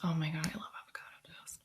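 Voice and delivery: breathless fast whisper of delight; gushing whisper. Real, not acted. Host confessing her avocado toast love, whispering.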